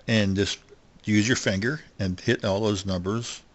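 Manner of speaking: usual speaking voice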